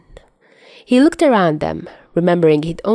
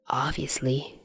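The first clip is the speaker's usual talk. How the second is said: softer grudging mumble, nearly a whisper; mumbled; 3 semitones lower, 13 dB quieter than usual. Acted. Chuya answering Dazai about keeping the cast dry, grudging mumble. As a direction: mumble it without looking up. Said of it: "Chuya mumbled in reply".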